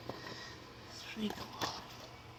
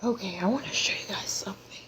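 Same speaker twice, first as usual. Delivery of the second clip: soft unvoiced whisper, leaning in close; 5 dB quieter than usual. Real, not acted. Whispering to viewers while showing off a gift. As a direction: hush it like a secret for the viewers.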